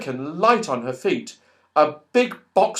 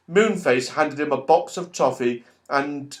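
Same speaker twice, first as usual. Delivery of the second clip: ordinary delivery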